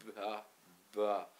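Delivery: ba and ba spoken as a blended syllable; ba, ba sounded out for a child; sounding out ba. Shown as a demonstration. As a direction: join B and A into ba, ba, deliberately. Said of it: "ça fait".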